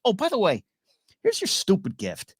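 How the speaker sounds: lightly acted flustered annoyance; mildly freaking out. Frazzled Gilly returning Sam's gift, mildly freaking out. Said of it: "She's kinda freaking out".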